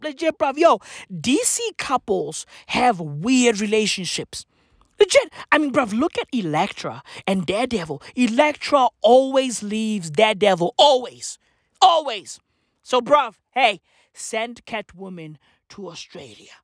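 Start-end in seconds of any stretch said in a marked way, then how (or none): none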